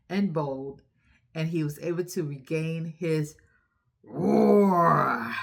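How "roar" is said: loud playful roar to end the story; lion roar. Shown as a demonstration. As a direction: let out a big proud lion roar.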